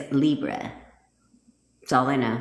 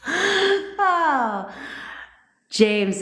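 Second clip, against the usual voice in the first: surprised cry; 11 semitones higher than usual. Real, not acted. Surprised at a viewer's comment.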